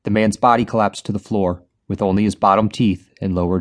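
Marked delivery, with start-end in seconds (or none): none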